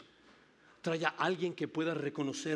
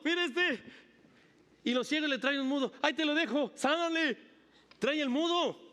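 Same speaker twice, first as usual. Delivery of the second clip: much higher, louder eager push; excited pleading; 13 semitones higher, 5 dB louder than usual. Acted. Blind men bringing the mute to Jesus, eager and excited. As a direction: hand him over like an excited friend.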